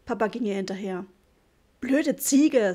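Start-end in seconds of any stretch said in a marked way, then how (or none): 1.8-2.7 louder, higher angry insult. A narrator's reading. Boy angry at his sister Jule, angry insult. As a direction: spit it at her in anger. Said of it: "sagte ich wütend".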